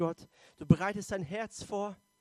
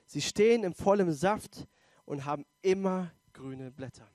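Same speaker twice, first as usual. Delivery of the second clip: raised, solemn reading voice, slowing on key lines; scripture reading; 4 dB louder than usual. Acted. Preacher reads a psalm to the congregation, solemn and raised.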